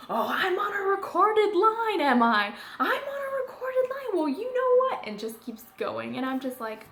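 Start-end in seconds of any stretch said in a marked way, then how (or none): 0.1-5.1 much higher and louder, sneering mock repeat; mocking outraged customer. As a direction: throw her words back at her in outrage. Customer mocks warning, then rants.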